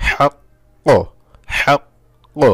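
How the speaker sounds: হাক্ক high, with a held q then a pop; strong qalqalah. Shown as a demonstration. Teacher shows pausing at verse end, strong bounce. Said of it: "ওয়াকফ করলে".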